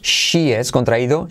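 ordinary talk, nothing marked

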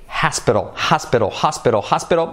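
"hospital" repeated with breathed H and tapped T; drilled HOSPITAL. Shown as a demonstration. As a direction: drill "hospital" as "HAS-pe-rol". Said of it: "La H aspirada". ("hospital" como "jásperol", repetido)

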